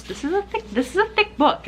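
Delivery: very high, cutesy squeak; playful high voice. Real, not acted. Vlogger showing viewers a thick book, playful high voice.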